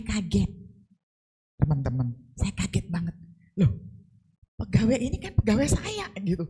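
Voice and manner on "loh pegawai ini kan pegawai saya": high, startled mock protest; indignant surprise. Mother protesting to son-in-law, high startled indignation. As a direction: act out the shocked pushback. Her indignant retort retold.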